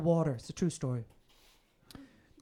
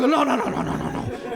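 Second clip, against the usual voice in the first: panicked no; 5 semitones higher, 5 dB louder than usual. Retold or imitated. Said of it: "I'm like".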